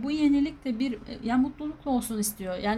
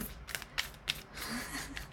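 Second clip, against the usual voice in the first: airy laugh under breath; soft breathy laugh; 4 semitones higher, 12 dB quieter than usual. Real, not acted. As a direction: let out a breathy giggle. Laughs at her own note.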